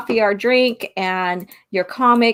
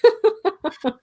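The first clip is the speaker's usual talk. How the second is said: high giggle; 11 semitones higher than usual. Real, not acted. Laughing at Norwegian genes joke.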